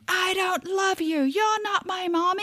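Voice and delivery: high, shrill tantrum scream; child screaming. Retold or imitated. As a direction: scream it like a feral toddler. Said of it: "like a tiny feral animal screaming".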